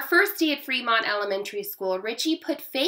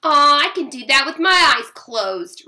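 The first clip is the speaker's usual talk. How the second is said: loud, higher scornful brag; croaked boast; 4 semitones higher, 8 dB louder than usual. A narrator's reading. Brother belittles sister's ballet moves, croaking a boast. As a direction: brag loudly over a sibling. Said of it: "Richie croaked".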